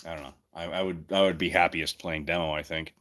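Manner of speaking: normal talking voice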